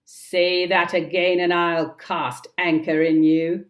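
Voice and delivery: loud, menacing reply; fierce threat. Acted. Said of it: "The voice responded fiercely".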